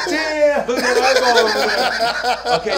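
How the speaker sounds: high, loud, laughing mock exclamation; imitating friend's gawk. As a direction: replay his gawking bit through laughter. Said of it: "Poop was just doing bit right there".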